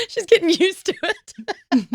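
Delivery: slowly, through real laughter; laughing. Real, not acted. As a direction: laugh the words out. Laughing at friend's story.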